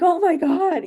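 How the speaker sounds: high, loud shocked Oh my God; shocked gasp. Retold or imitated. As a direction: gasp it like a horrified parent. Parents reacting to screaming child.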